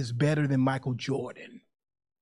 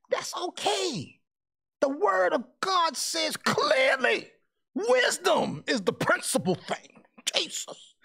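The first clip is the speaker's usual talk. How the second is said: mock preaching; 12 semitones higher, slower than usual. Slips into preaching.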